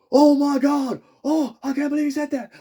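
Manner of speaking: high, loud mock-American outrage; American accent. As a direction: shriek it in a put-on American accent. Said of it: "they did American accent".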